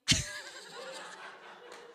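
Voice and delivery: high, airless giggle; a real, silent-shaking laugh; breathy laugh. Real, not acted. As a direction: laugh at your own impression. Laughs at her own impression.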